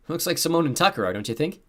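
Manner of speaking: high, loud, light quip; joking. A narrator's reading. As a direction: toss it out as a joke. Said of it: "the boy next to him joked".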